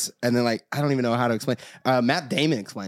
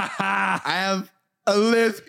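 lisp impression; 7 semitones higher, much slower than usual. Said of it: "the guy was just like".

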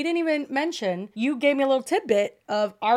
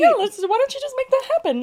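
high, fast mock eagerness; sarcastically eager, pushing it; sarcastic; 5 dB louder, faster than usual.

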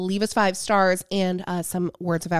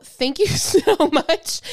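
laughing; 7 semitones higher, 7 dB louder, slower than usual. Real, not acted. Laughing mid thanks.

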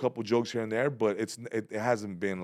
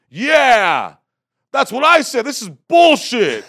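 much higher, much louder jeering shout, slowing down; mock heckler yell; 17 dB louder, much slower than usual. Crowd member joining the heckling, loud mock yell. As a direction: yell it from the back like a fed-up heckler.

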